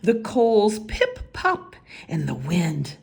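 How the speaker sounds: ordinary delivery, nothing marked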